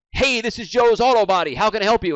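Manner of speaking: loud, high-pitched shop phone answer; hyped phone greeting. Acted. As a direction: answer the phone at full energy. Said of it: "I call someone and they say".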